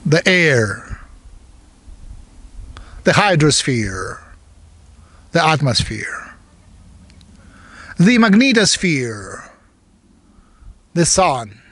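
meditation guide with long pauses between phrases; guided meditation. Real, not acted. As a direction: give each phrase weight, let it fall, then leave silence.

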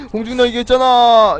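gasp, then loud and high; surprised gasp. Real, not acted. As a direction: catch a breath in delight. 원하던 아이템 발견. (놀라서 숨을 들이켜며 높게)

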